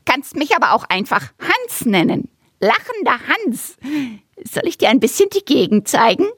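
high, cocky kookaburra voice; chatty bird showing off his land; cheeky bird. Acted. Kookaburra Hans offers a tour.

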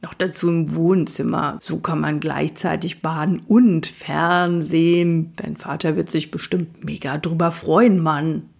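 lounge back and shrug it off; laid-back unicorn. Acted. Lazy unicorn Magic brushes off Fred's panic, unbothered.

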